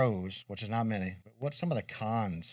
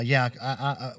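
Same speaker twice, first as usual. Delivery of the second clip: ordinary talk, nothing marked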